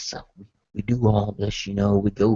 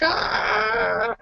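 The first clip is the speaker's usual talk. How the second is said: high-pitched drawn-out "ah"; mock squeal of icy pain; brain-freeze cry. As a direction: cry out from a cold head rush. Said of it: "you're getting a cold head rush".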